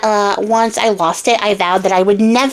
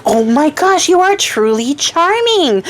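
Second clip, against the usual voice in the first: higher, slow and swooning with delight; swooning princess; 6 semitones higher, slower than usual. Acted. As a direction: melt over him as you say it. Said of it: "she's like".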